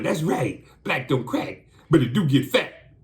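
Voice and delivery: high, mocking comic impression; scornful mock-comedian voice; Eddie Murphy. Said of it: "in Eddie Murphy voice".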